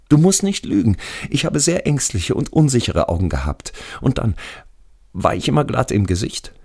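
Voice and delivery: sehr hohe, beharrliche Stimme; ängstlicher toter Schulfreund. Acted. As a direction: als toter Freund widersprechen, deutlich höher. Radi questions narrator. (hoch, mit lautem Einsatz widersprechend)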